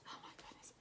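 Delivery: whispered oh my goodness; shocked whisper. Acted. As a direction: gasp then a breathless whisper. Shocked aside to children.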